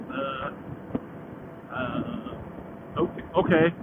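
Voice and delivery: very low, soft hesitant uhs; retold sheepish agreement, dropped low and quiet; mock reluctant surrender. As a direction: give in meekly, stalling first. Giving in to wife's jacket demand.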